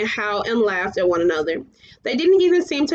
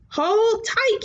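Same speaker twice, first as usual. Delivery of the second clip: high urgent shout; 10 semitones higher than usual. A narrator's reading. Falkor warns Atreyu over the storm, shouting. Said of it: "he shouted".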